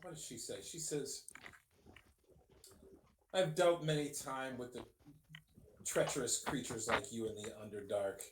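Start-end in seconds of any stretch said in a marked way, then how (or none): 3.3-8.2 low, measured villainess voice, sinking at the end; condescending threat. Acted. Dark elf warns the intimidating adventurer, low condescending threat. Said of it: "a condescending look".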